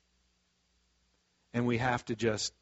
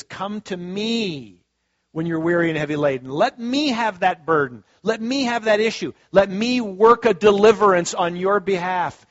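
louder, rising preaching plea; preaching the Lord's call; 6 semitones higher, 9 dB louder than usual. Acted. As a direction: call them in as the Lord, swelling louder. Voices the Lord, preaching.

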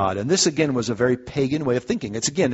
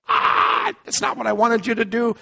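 mock frustrated king; 8 semitones higher than usual. Voicing angry king Balak.

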